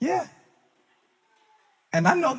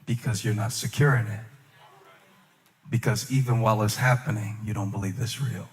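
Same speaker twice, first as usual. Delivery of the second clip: inner voice; 8 semitones lower, 6 dB quieter than usual. Acted. Voicing the inner negative thought.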